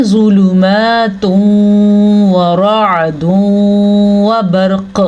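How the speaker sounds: ज़ुलुमातुन व रअदुन slow, nasal hum joining each व; ghunna recitation demo. Shown as a demonstration. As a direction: hold the hum into व, clear ain in रअदुन. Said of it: "गुन्ने के साथ कैसे पढ़ेंगे".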